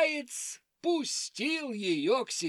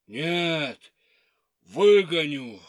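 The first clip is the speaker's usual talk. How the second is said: low bear voice; 5 semitones lower, 8 dB louder, slower than usual. Acted. Bear insists.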